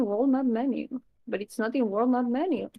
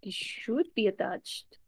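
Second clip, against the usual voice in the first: whispering; 7 dB quieter than usual. Real, not acted. Muttering to himself while coding.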